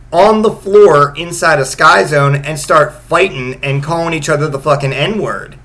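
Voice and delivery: ordinary talk, nothing marked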